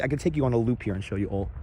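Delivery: ordinary talk, nothing marked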